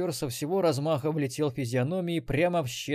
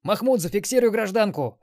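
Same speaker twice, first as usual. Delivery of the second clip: loud, raised-pitch command; barked order; 9 semitones higher, 7 dB louder than usual. A narrator's reading. Xenobyte ordering Mahmud to hold madam, loud bark. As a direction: bark the instruction urgently. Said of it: "рявкнул Ксенобайт".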